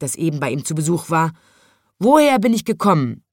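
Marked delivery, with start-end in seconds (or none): 2.0-3.2 loud, raised question, lightly voiced lion; lion asking. A narrator's reading. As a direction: ask insistently as the storybook lion. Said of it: "hat der Löwe das Rotkehlchen gefragt". (laut fragender Löwe)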